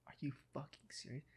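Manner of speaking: furious hushed whisper. Retold or imitated. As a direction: whisper it in disbelief. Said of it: "I was like".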